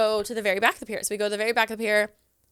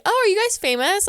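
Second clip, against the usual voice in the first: curious stranger; 10 semitones higher, 5 dB louder than usual. Retold or imitated. Imitating stranger asking.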